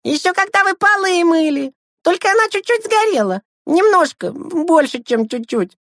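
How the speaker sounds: put-on very high, squeaky child voice; high-pitched kid confession; little brothers, sheepishly calling out. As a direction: pipe up like small kids owning up. Younger brothers confess burnt chicken.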